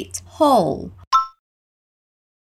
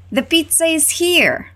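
The pizza is here said higher and bright, like good news; happy news tone; 7 semitones higher, slower than usual. Shown as a demonstration. Teacher models good-news tone: pleased, higher announcement. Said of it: "Essa é uma notícia boa".

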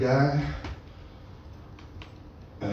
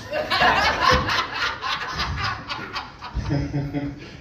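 laughing; 13 semitones higher than usual. Real, not acted. Cracks up mid sentence.

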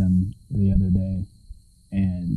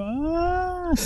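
startled yelp; 6 dB quieter than usual. Retold or imitated. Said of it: "You just hear me go".

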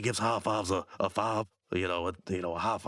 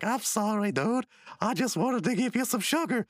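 high put-on pleading voice; apologetic brother; 11 semitones higher than usual. Brother apologizing at a birthday party, high mock pleading. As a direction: apologize in a pitched-up cartoon voice. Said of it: "He's like".

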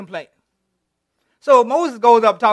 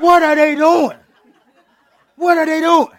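loud, high, laughing outrage; hammed-up angry shout; mad Moses; 7 semitones higher, 7 dB louder than usual. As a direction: yell it half-laughing at the partiers. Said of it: "he mad".

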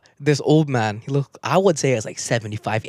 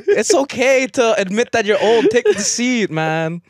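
slow pleading; 9 semitones higher, slower than usual. Retold or imitated. Pleading with old man.

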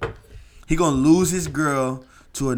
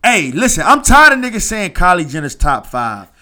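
loud, higher heated declaration; loud opinionated ribbing; 7 semitones higher, 10 dB louder than usual. Real, not acted. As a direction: shout your hot take at friends. Heated debate over celebrity rankings.

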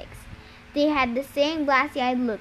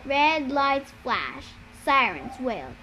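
ordinary delivery

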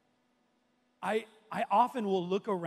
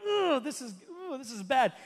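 high, loud groan of secondhand embarrassment; acted-out cringe, pitched up; cringing; 6 semitones higher, 4 dB louder than usual. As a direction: squirm aloud as if your skin is crawling.

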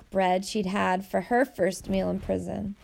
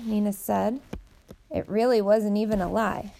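usual speaking voice

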